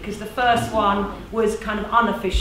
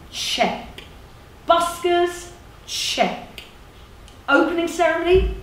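persuasive community organiser; 5 semitones higher, slower than usual. Acted. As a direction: run briskly down a meeting checklist. Plays organiser running down checklist.

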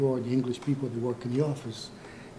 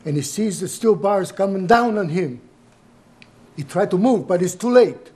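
louder, higher, horrified account; raised voice, swelling with alarm; agitated retelling; 6 semitones higher, 9 dB louder than usual. Acted. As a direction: relive the accident as you tell it. Retelling father's accident, building.

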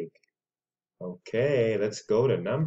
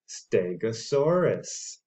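ordinary talk, nothing marked

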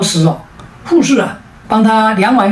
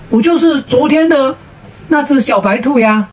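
lightly voiced squirrel, pitched up high; a higher, teasing reveal without actual laughter; playful giggly squirrel; 6 semitones higher than usual. A narrator's reading. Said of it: "小松鼠笑嘻嘻地说". (略带笑嘻嘻的高音语气)

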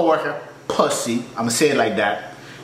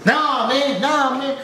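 whiny customer impression; 10 semitones higher, much slower than usual. Customer protesting again.

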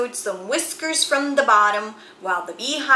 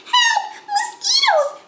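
very high, loud squeal of panic; shrill cry for help; 4 dB louder than usual. A narrator's reading. Girls covered in bites, crying out high. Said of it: "cried the girls".

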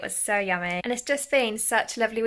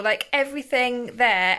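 ordinary delivery

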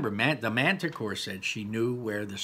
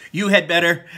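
stern warning; 7 semitones higher, 11 dB louder than usual. Acted. Laurel threatening.

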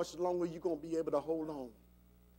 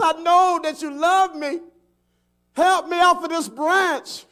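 high, loud acted appeal; insistent shout from a stranded man; yelled plea; 11 semitones higher, 12 dB louder than usual. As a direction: holler to heaven for rescue. Stranded man pleads with heaven.

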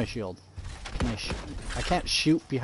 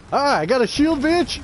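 much higher, loud taunting shout; triumphant gloat; 12 semitones higher, 5 dB louder than usual. Real, not acted. Streamer gloats at enemies after getting a shield, loud and much higher. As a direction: crow over the enemy.

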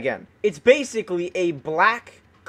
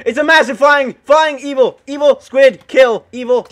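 loud, raised staccato exclaiming; alarmed outburst; 4 semitones higher, 8 dB louder than usual. Real, not acted. Gamer killing a flying evil squid, alarmed outburst. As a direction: spit out frantic word bursts.

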